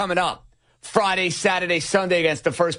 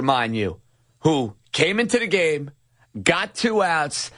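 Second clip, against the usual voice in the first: ordinary talk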